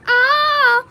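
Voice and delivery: loud high cry; playful yell. Pretend. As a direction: belt out a sudden ah. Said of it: "three, two, one".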